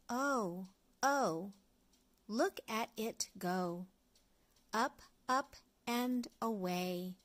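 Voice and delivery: usual speaking voice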